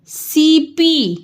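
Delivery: सीपी very slow with सी held; stretched सी. Shown as a demonstration. Teacher modelling a stretched सी in सीपी. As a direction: hold the सी long in सीपी. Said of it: "सी खींच के बोला".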